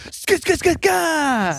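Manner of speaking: high, screechy repeated stab sounds; mock stabbing noises. Imitating the old lady's attack, shrill stabbing noises. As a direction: imitate rapid stabs, piercingly high.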